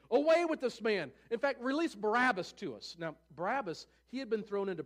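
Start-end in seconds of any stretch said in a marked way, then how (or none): 0.1-3.0 loud, high cry of a mob; raised voice, demanding as a crowd; crowd shouting. A narrator's reading. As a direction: call it out like an angry crowd. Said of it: "the whole crowd starts shouting".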